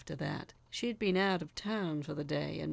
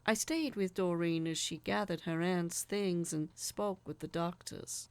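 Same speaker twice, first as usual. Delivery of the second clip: plain reading voice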